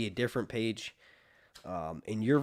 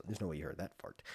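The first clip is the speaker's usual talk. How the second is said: normal talking voice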